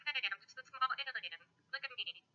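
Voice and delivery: talking into fan. Shown as a demonstration. Small alien asking to be looked at, fan-chopped voice effect. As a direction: chop the words up as if through fan blades. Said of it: "this is like a fan blowing in your face".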